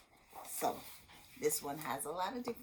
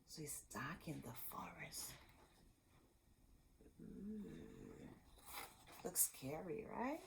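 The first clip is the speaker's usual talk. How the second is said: spooky hush; 16 dB quieter than usual. Acted. Teacher whispering to children about the dark forest. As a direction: hush it like a scary secret. Spooky aside to young viewers.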